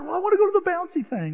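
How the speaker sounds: put-on high, quick kid voice; hammed-up little-kid request; imitated child. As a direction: plead fast like an excited child.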